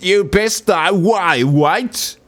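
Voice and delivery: hammed-up British accent, rising into a puzzled what; mock British accent. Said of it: "Such good accent".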